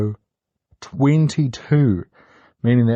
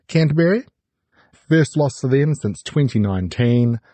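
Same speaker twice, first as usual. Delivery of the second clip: usual speaking voice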